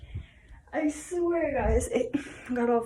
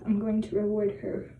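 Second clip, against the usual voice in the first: ordinary talk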